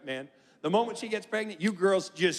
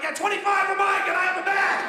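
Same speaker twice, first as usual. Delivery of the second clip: high, loud indignant yell; mock outraged shout; 9 semitones higher, 4 dB louder than usual. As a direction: yell it like a starving husband. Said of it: "I'm like".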